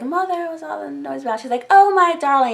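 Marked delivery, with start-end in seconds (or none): 0.0-1.4 little son. Retold or imitated. Robin walking in on his arguing mother, put-on high child voice. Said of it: "Robin comes in, and he's like". 1.7-2.5 put-on high, loud gushing over a child; hammed-up doting coo, pitched way up; coddling mother. Lysa fussing over her son Robin, exaggerated coddling. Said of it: "she basically coddles him".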